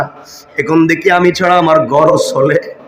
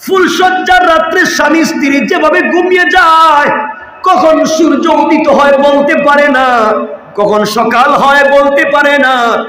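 high, ringing sermon cry; full-force preaching; 10 semitones higher than usual. Acted. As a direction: preach it out in a raised, drawn voice.

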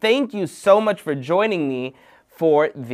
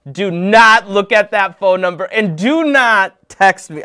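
loud, higher, punched do-nots; mock-stern warning; 7 semitones higher, 8 dB louder than usual. Host forbids viewers to use his phone number, mock stern. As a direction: lay down the law in play.

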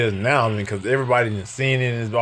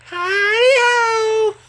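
very high, stretched squeal; 3 dB louder than usual. Real, not acted. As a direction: belt it out like the cartoon. Singing the Christmas Poo call.

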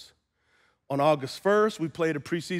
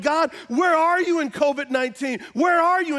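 high, raised-voice mock plea; imitated desperate demand; 11 semitones higher, 6 dB louder than usual. Believer asks God where he is in covid, imitated demanding cry. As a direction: call out to God as a frustrated believer.

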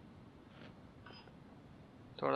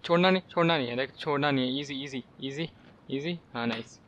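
ordinary delivery

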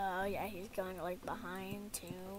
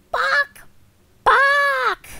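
high, loud "bok" clucks; high-pitched mock hen cry; chicken squawk; 12 semitones higher, 8 dB louder than usual. Imitates a chicken.

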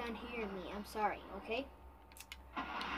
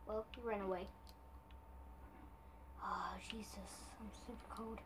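normal talking voice

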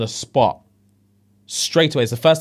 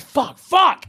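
high, loud swearing; imitating him screaming in the room; hammed-up panicked swearing; mock scream; 14 semitones higher, 3 dB louder than usual. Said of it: "He was screaming in there".

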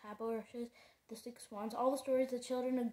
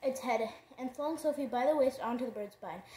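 ordinary delivery

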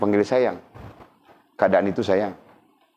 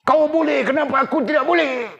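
much higher, defiant retort to the husband; defiant talking back. Acted. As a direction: snap back at him in a high, indignant voice. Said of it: "daripada kau melawan-lawan". (isteri membantah suami dengan suara tinggi dan melawan)

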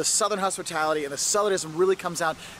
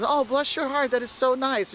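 high, syrupy fake sweetness; sugar-coat the insult; mock-sweet Southerner; 7 semitones higher than usual. Southerner sweetly insulting a tourist, high and syrupy.

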